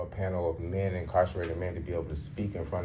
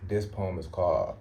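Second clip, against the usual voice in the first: ordinary delivery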